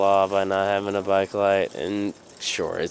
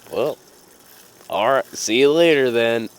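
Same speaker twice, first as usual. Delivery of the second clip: loud, raised-pitch send-off; re-enacted shouted goodbye; retold parting call; 5 semitones higher, 9 dB louder than usual. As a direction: call it out after someone leaving.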